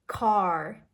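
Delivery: "Car" with a heavy final R; "Car" drawn out, R strongly sounded; strong American R. Shown as a demonstration. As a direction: curl the tongue up to end "Car". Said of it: "it's a strong ra sound".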